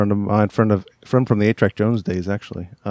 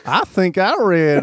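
high, slow Virginia country drawl; Winchester, Virginia accent; 8 semitones higher, slower than usual. Acted. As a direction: read it like a Winchester, Virginia local. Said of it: "Winchester, Virginia English".

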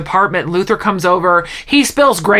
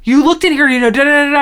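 raised voice, lightly acting out panic; mock freak-out; 6 semitones higher, faster than usual. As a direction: retell an agitated accusation, higher pitched. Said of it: "he's, like, freaking out".

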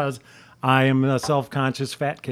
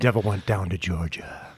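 golf voice; 6 semitones lower, 3 dB quieter than usual. Pretend. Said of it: "I'm doing the golf voice again".

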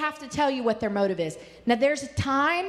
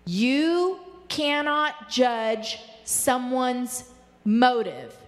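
very slow, each word weighed; slow teacher emphasis; slow deliberate repeat; much slower than usual. Shown as a demonstration. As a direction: spell it out slowly for the class. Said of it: "I'm gonna say that again".

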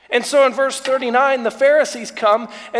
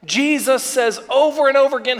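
ordinary delivery

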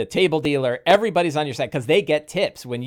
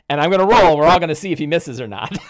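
ordinary talk

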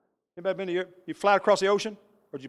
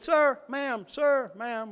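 imitated Italian accent, higher pitch; lightly imitated Italian caller; 6 semitones higher, much slower than usual. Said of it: "with a heavy Italian accent".

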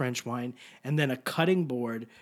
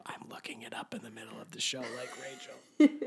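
whisper; 3 semitones lower, 6 dB quieter than usual. Real, not acted. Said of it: "whispered joke aside".

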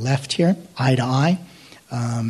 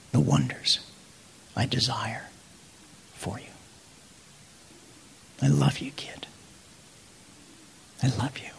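hushed, tender voice fading to breath; whispering; 4 dB quieter than usual. Acted. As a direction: whisper intimately with long pauses. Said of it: "he whispers through his word".

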